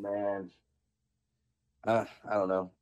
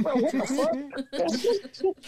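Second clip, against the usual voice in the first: high, slow, laughing outrage; laughing disbelief; 14 semitones higher, much slower than usual. Retold or imitated. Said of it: "And it's like".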